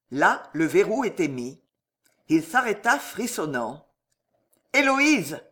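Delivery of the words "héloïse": raised, higher call of her name; crying out. A narrator's reading. Husband calls to his wife behind a bolted door, crying out. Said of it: "cria-t-il".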